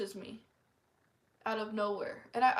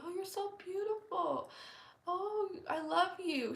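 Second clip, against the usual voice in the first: gushing neighbour lady; 10 semitones higher than usual. Retold or imitated. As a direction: coo it like a smothering neighbour. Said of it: "would always tell me".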